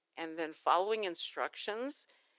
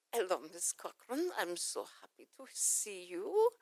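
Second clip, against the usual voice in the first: put-on Dracula-like accent. Doctor greets patient before taking blood, Dracula-like accent. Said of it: "who sounds like Dracula".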